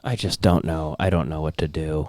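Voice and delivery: very low, soft, defeated imitation; mock helpless wife. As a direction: murmur it helplessly, well under your voice. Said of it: "Look at his wife. She's like".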